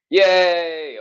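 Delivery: loud, high ironic yay; mock cheer. Real, not acted. Laughing at her own drippy shirt stain with a loud ironic cheer. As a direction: cheer sarcastically at the mishap.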